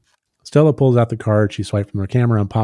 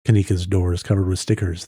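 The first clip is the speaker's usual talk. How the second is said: normal talking voice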